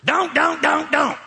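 high-pitched mock scolding; religious nag. Preacher mimicking religious people against Jesus' invitation, mock nagging. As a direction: parody a killjoy repeating the ban. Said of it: "What do religious people say?".

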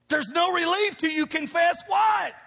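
call-and-response shout to the congregation; preacher's shout. Acted. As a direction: shout the question for the crowd to answer.

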